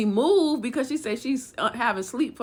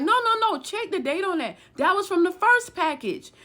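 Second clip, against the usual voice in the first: high-pitched retold panic; urgent pleading; 9 semitones higher than usual. Woman with straps pointed at her, urgent pleading. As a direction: plead her case quickly before they act, voice shooting up.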